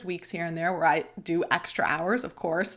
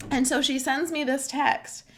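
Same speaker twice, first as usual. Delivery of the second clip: ordinary delivery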